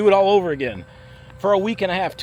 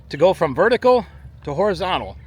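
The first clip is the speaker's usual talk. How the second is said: ordinary talk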